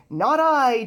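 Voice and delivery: loud, high toot of a reply. Acted. Tugboat answering the boy, a loud high toot. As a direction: toot it out proudly. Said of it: "tooted Scuffy".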